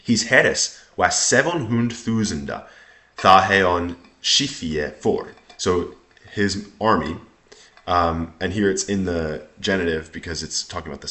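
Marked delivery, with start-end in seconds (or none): 0.0-5.3 put-on slow, measured reading in Old English; Old English recitation. Shown as a demonstration. Teacher reading a passage to students, Old English recitation. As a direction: recite the old text slowly and deliberately.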